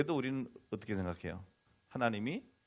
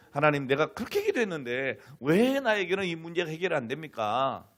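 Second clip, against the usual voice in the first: higher, louder voiced protest to God; pleading complaint; 7 semitones higher, 9 dB louder than usual. Believer asking God why prayer fails, complaining. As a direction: act out a frustrated plea.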